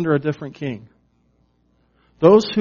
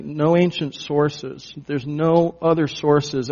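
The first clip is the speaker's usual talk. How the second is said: slow and deliberate; slower than usual. Shown as a demonstration. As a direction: spell it out slowly for the class. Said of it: "listen carefully".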